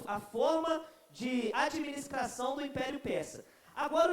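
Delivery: ordinary delivery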